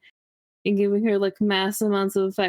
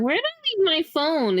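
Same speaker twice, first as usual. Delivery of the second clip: ditzy self; 8 semitones higher than usual. Retold or imitated. Imitating her own scatterbrained self.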